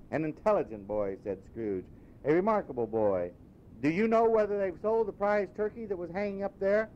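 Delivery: high, eager, then slowing warmly; an old man suddenly beaming at a stranger; delighted Scrooge. Acted. Scrooge asking the boy the day, delighted.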